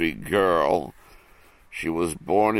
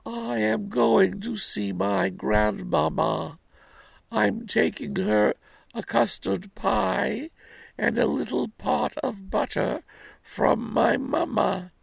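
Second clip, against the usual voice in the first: put-on little girl voice. Girl answers the wolf.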